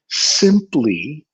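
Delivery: SIMPLY stretched out slowly; stress by length. Shown as a demonstration. Teacher stressing a word in speech by length, drawn out. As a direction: take extra time over SIMPLY. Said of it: "taking a little more time".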